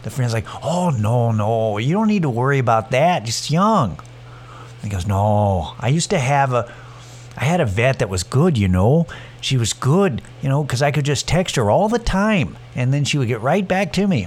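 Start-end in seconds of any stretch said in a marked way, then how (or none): 0.6-3.9 put-on sing-song Upper Midwest accent; Fargo-style accent. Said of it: "imagine them having an accent". 5.1-14.3 sing-song mock Fargo accent; exaggerated Upper Midwest lilt and accent; Fargo-style accent. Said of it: "imagine them having an accent".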